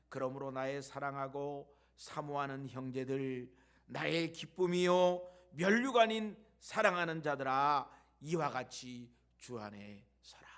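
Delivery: solemn measured reading of the verse; scripture reading. Acted. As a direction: read the apostle's words with weight, swelling loud in the middle. (성경 구절을 엄숙하게 낭독)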